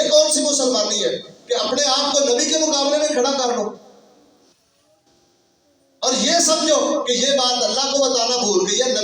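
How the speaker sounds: high, indignant sermon rebuke; forceful preaching. Acted. Preacher rebuking those who disfigured the faith, forceful. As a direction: denounce them with a high, insistent voice.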